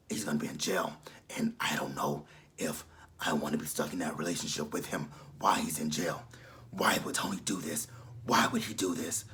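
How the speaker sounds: low, breathy whispered impression of Angela; Angela's raspy whisper. Vlogger mimicking Angela's voice, low whispered rasp. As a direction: croak it out like a lifelong smoker. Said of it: "that's how Angela's voice sounds".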